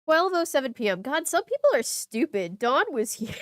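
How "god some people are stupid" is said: ordinary talk, nothing marked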